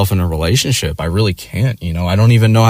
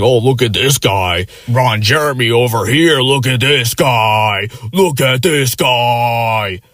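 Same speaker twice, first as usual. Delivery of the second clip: slow, drawn-out teasing, pitched up; mock heckling comic; 3 semitones higher, slower than usual. Imitating the comic slowly teasing a guy in the bar. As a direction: stretch it out like a comic pointing someone out. Quoting comic pointing out people.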